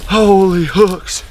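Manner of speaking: high, thrilled hunter exclaiming; elated. Real, not acted. Elated after turkey kill.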